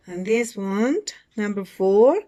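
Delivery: ordinary delivery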